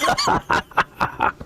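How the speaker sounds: long, voiceless staccato laugh; theatrical crazed chuckling; mad dwarf cackle. Acted. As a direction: cackle crazily at your own friendlessness. Dwarf laughs after having no friends.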